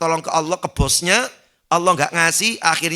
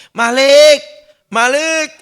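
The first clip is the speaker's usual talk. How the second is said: higher, louder pleading calls; begging shout; 8 semitones higher, 9 dB louder, much slower than usual. Acted. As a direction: cry out the name, desperate. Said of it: "berteriak-teriak merayu".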